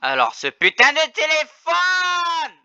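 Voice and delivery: slow, high, loud furious shout; angry yell. Real, not acted. Streamer shouting at his phone, slow angry yell. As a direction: bellow it out at the phone.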